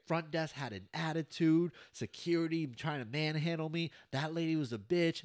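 mock-furious complaint, slowing on the first line; angry rant. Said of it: "he started cursing everybody out".

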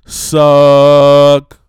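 a single blasted word, held long; drawn-out loud sucking verdict. Acted. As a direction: slam the verdict down. Verdict on Batman v Superman.

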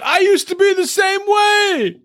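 high, strained imitation shout; over-the-top high-pitched yell; mock yelling. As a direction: holler it as a hammed-up confession. Continues imitating Kyle's yelling.

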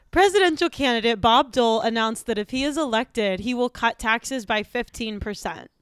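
ordinary talk

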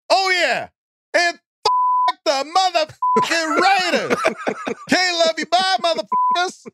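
hammed-up Jackson rant over the silent D; Sam Jackson. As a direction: cuss him out like an outraged Jackson. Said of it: "Sam Jackson".

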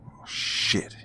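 whispered curse under the breath; muttered. Acted. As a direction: say it under your breath, barely voiced. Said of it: "he muttered to himself".

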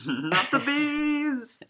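high, slow mock scream from a film; hammed-up Nicolas Cage bee scream. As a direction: wail it out in hammed-up panic. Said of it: "Nick Cage references".

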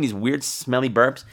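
ordinary delivery

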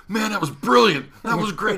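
high, loud, delighted outburst; imitating an overjoyed promoter; thrilled praise. Delighted man praising the accidental fall.

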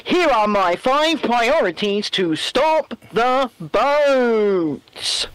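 slow, grave mock prime minister voice; Rishi impression. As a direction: ham up a solemn politician's announcement. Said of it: "let me do my best Rishi impression".

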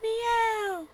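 high, small mock meow; a preacher mocking the devil as a house cat; tiny cat meow. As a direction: make the devil a harmless kitten.

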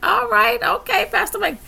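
high, delighted all right; a delighted okay; impressed. Retold or imitated. Reacting to gospel songs.

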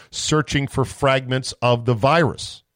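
ordinary delivery

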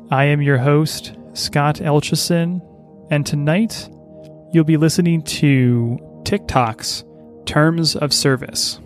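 slow, soothing bedtime host voice; sleep story narrator. Acted. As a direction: lull the listener toward sleep, unhurried. Sleep podcast host intro.

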